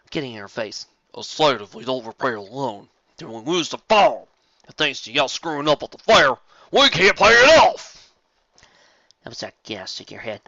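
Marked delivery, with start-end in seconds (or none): 1.2-8.1 berating Big Mac. Acted. Big Mac blaming Applejack for the farm, building shout. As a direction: build up to a furious yell.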